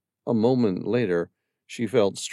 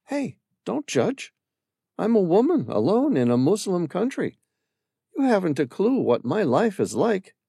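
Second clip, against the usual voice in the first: raised woman's voice, a touch defensive; defensive Amira; 10 semitones higher than usual. Acted. Amira to Sam, mildly defensive about the smugglers. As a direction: push back on his judgement.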